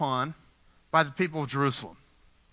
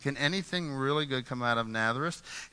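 lower, softer mock scoff; sneering doubt; 5 semitones lower, 5 dB quieter than usual. Nathanael scoffing at Nazareth, quiet sneer. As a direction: play the skeptic looking down his nose. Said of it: "He's rolling his eyes".

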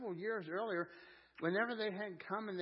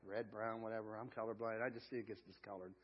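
ordinary talk, nothing marked

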